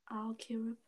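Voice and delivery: quiet whispered reply; breathy whisper. Real, not acted. Quiet reaction.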